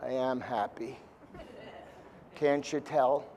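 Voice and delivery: low, soft, flat-out gloomy "I am happy"; gloomy reply. Retold or imitated. Depressed person answering being told to get happy, low and soft. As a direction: drag the words out like a depressed person. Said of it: "and they go".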